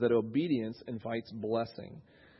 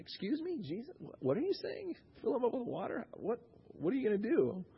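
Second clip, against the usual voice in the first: much higher, hesitant mock disbelief; incredulous; 9 semitones higher, 4 dB quieter than usual. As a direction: question the order like a baffled servant. Acted baffled servants questioning Jesus.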